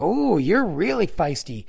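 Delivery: high, slow mock-teasing ooh; stupid clown voice. Clown teasing the man who smacked his balloon, stupid voice. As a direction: imitate a clown toying with someone. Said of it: "he's making this stupid voice".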